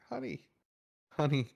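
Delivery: a drowsy dad waving it away; sleepy dad. Retold or imitated. Dad dismissing wife half asleep.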